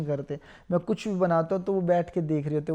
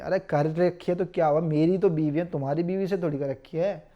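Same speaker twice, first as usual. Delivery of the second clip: normal talking voice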